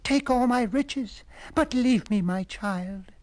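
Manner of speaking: high, slow desperate begging; high tearful plea, drawn out; pleading queen. A narrator's reading. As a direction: beg slowly, clinging to hope. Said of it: "cried the queen".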